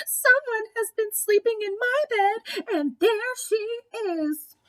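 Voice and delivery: put-on very high tiny Baby Bear voice, slowing at the end; wee little voice. A narrator's reading. Baby Bear finds Goldilocks in his bed, very high wee voice. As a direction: squeak it out like a startled little cub. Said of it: "cried in his wee little voice".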